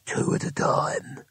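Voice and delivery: slow, unvoiced hooded mutter. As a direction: whisper it low and slow. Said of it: "it muttered".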